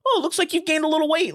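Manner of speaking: high-pitched needling grandpa impression; mock grandpa. Grandpa to grandson about his weight, high mocking voice. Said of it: "he would be like".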